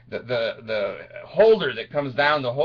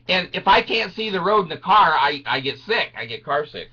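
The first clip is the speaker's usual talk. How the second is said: normal talking voice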